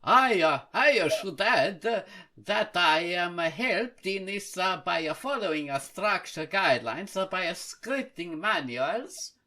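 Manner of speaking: reading the post in a loud, hammed-up Italian accent. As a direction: read it like a cartoon Italian. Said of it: "my Mario version".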